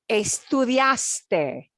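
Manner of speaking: caminaste drawn out slowly, each syllable clear; slow demo. Shown as a demonstration. Teacher modeling the conjugation, very slowly. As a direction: stretch out caminaste. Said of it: "here's what it will sound like".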